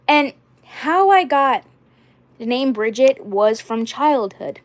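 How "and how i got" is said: normal talking voice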